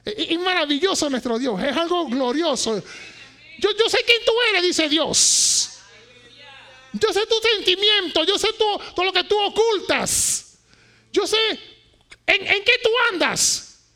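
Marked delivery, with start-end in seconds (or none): 3.6-13.8 loud, high declaring as God. Acted. Pastor voicing God to the church, loud and high. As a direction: proclaim it as God speaking.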